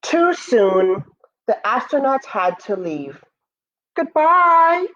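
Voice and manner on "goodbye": high, louder farewell call; calling goodbye. Acted. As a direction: call after the ship floating away. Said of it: "the moon said".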